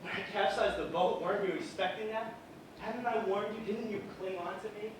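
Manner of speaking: corner her with each question; angry interrogation. Acted.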